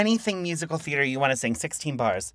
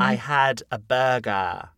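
slow, low, wide Australian accent; 4 semitones lower, slower than usual. Shown as a demonstration. Host retrying the accent as coached, in a slow, wide Australian accent. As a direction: practise a broad Australian accent slowly. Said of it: "Australian to me is a little bit wider".

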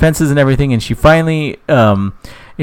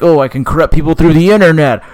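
louder, higher mock devil voice; hammed-up Satan; 5 semitones higher, 6 dB louder than usual. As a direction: lightly play a scheming devil. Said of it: "It's the devil".

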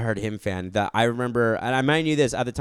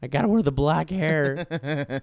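put-on sulky emo kid; 5 semitones higher, slower than usual. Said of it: "They're like".

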